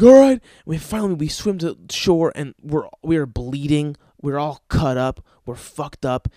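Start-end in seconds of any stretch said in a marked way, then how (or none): 0.0-0.4 loud, high mock shout; acted high yell as the friend; yelled reply. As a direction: shout back, giving in. Friend yells back, giving in.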